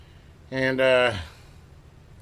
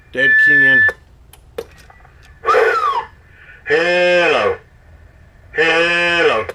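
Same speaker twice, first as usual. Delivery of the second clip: loud mic test; 6 semitones higher, 12 dB louder, much slower than usual. Acted.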